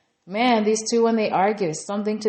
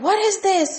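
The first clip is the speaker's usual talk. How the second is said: high, loud mock outrage; hammed-up yelled demand in a retold quarrel; Yasmin; 13 semitones higher, 6 dB louder than usual. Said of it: "they're yelling and screaming".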